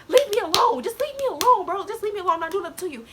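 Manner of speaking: high, loud whiny plea; imitated crybaby pleading. Student begs bullies to back off, whiny plea. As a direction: re-enact a worked-up crybaby protest. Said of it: "I'm a crybaby".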